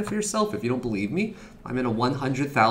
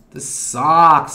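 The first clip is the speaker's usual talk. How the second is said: disappointed sigh; 3 semitones higher, 11 dB louder than usual. Real, not acted. Trade going wrong.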